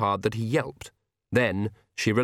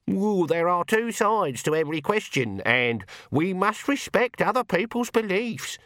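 high, put-on fair-minded voice; mocking mimicry; 9 semitones higher than usual. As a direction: mimic a wishy-washy moderate. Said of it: "you wouldn't catch me saying things like".

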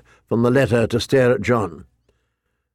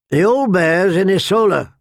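higher-pitched announcement from a guard; guard announcing; 7 semitones higher, 3 dB louder than usual. A narrator's reading. Guard tells Jon where the old bear is, announcing. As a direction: announce it firmly from your post. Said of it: "one of them announced".

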